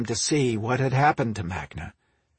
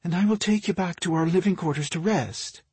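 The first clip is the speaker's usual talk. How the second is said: higher, gentle wifely plea; tender request in a raised pitch; 6 semitones higher than usual. A narrator's reading. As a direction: coax him gently, voice lifted. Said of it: "requested in a gentle tone".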